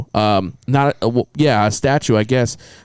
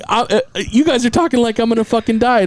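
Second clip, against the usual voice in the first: alarmed retort; 9 semitones higher than usual. Retold or imitated. Retold alarmed retort to clinic.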